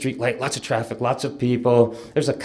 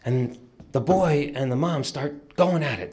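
ordinary talk, nothing marked

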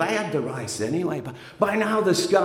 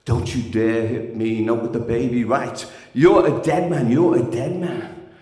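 low threat; 8 semitones lower than usual. Retold or imitated. Threat after the slap.

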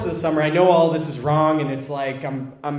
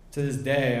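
ordinary talk

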